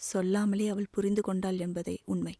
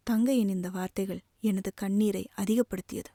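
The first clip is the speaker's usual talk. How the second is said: ordinary delivery, nothing marked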